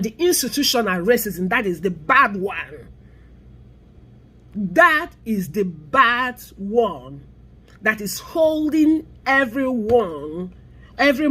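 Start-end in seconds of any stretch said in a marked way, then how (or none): 4.5-7.2 slow emphatic repeat. Shown as a demonstration. Warning viewers about institutional racism, slow and weighty. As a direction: drag each word out slowly. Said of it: "repeats for emphasis".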